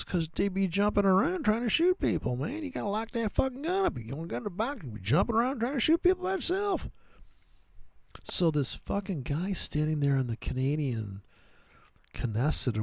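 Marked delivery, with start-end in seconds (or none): 0.0-6.9 high, fast, put-on voice of a jittery gun-shop clerk; gun clerk impression. Gun-shop clerk telling him to lock up the gun, in a high, hammed-up voice. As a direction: warn about the jumping gun in a squeaky, hammed-up clerk voice. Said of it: "He goes".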